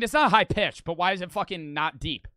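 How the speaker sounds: normal talking voice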